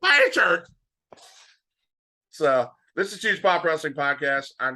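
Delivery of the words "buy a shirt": much higher, louder comic squeak; high squeaky voice. Acted. Echo in high put-on voice.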